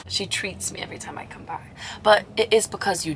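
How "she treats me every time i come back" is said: whisper. Real, not acted. Whispered aside about mum.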